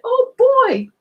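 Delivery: a little truck bursting with happiness; happy little garbage truck squealing with delight; overjoyed little truck. Acted. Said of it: "he said".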